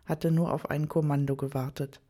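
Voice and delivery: usual speaking voice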